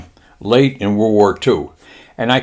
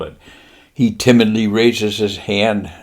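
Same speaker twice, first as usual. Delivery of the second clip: ordinary talk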